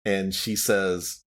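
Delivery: ordinary talk, nothing marked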